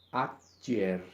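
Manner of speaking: ayer said as a Latin demo word, clear and careful; Latin example word. Shown as a demonstration. Teacher reading a Latin example word, clear pronunciation. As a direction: read ayer slowly like a model word. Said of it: "como en la palabra".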